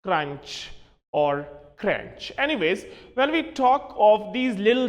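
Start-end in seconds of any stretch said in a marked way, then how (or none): 0.0-2.3 CRUNCH as "cranch", then "crench"; lower-pitched CRUNCH, said "cranch" then "crench"; wrong pronunciations. Shown as a demonstration. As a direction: show both mistakes, n before ch.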